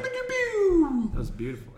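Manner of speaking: high, slow, swooning over it; mock awed high voice. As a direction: gush like it's magic. Mock awe at sparks.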